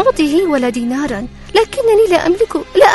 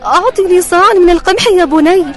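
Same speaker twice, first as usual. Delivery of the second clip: higher, pleading poor widow; 10 dB louder than usual. Acted. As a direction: ask humbly like a poor widow. Poor widow asks for wheat.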